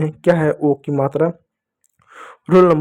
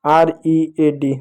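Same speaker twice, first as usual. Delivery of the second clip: slow spelling out; much slower than usual. Shown as a demonstration. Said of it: "जैसे अगर मैं कुछ शब्द लिखूँ".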